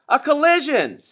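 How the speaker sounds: very high, loud alarmed shout; crowd shout. A narrator's reading. Crowd on shore seeing the crash, very high loud shout. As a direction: cry out in alarm. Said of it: "shouted the crowd on shore".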